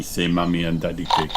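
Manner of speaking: plain reading voice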